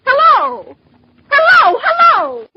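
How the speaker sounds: high, loud, anxious calls into the phone; sharp repeated calls, growing desperate; calling out. Acted. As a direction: call out frantically for someone to answer.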